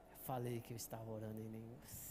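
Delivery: very low, hushed told-you-so, almost inward; silent told-you-so. Pretend. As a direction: say it only with your eyes. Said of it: "dentro do meu olho, eu mandei aquela". (sussurro interior de "eu te disse")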